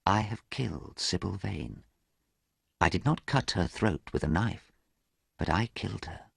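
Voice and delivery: soft, hushed self-accusation; guilty Dorian whispering to himself. A narrator's reading. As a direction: murmur it to yourself in guilt. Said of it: "whispered Dorian to himself".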